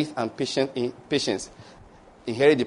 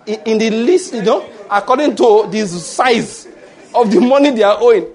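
mock pleading prayer; 7 semitones higher, 7 dB louder than usual. Preacher acts out greedy prayer to God.

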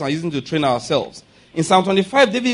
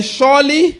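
louder, called out high; preaching declaration; 7 semitones higher, 5 dB louder, slower than usual. Shown as a demonstration. Preacher calls the psalm for the congregation, loud. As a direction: call it out for them to echo. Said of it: "Let's declare".

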